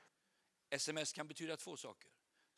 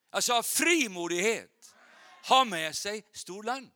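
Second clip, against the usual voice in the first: loud, high-pitched rallying sermon; full-force preaching; 8 semitones higher, 12 dB louder than usual. Real, not acted. Preacher challenges the congregation to dare boldness, full-force preaching. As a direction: fire up the congregation, punching the key words.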